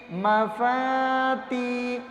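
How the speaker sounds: مفاتی with long, held aa and ee; short first syllable, stretch both others in مفاتی; drawn-out syllables. Shown as a demonstration. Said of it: "تا کو کھینچ کر پڑھیں گے". (مفاتی کو کھینچ کر)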